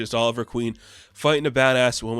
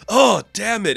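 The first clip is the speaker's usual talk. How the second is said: loud, higher retold outburst; mock angry; 9 semitones higher, 8 dB louder than usual. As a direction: burst out in acted frustration. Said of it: "like, angry at what Leanne said".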